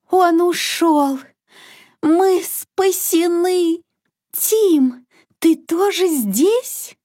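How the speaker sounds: lightly voiced high mother duck; relieved mother duck. Mother duck sees the monster has gone, high relieved voice.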